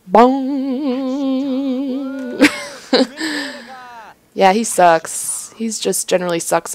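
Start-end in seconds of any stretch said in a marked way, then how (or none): 0.1-2.7 long humming "bong" dying into a laugh; ringing gong imitation. Shown as a demonstration. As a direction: strike the word, then let it resonate. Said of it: "like, where you ring it".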